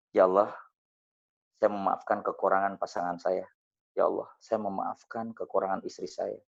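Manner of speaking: soft, low prayer voice; guided prayer. Acted. Speaker modelling a forgiveness prayer for the audience, soft and low.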